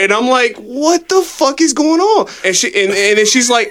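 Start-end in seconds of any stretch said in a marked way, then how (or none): 0.6-2.3 slow, pitched-up disbelief, half laughing; baffled disbelief. Retold or imitated. Telling friends about a baffling bar encounter, baffled and slow. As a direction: let the confusion stretch each word out. Confused reply to a stranger's apology.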